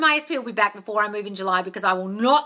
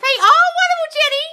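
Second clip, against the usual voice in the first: high, loud gushing praise for Jenny; delighted gush; 10 dB louder than usual. Real, not acted. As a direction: gush over her good news. Reacting to Jenny's success.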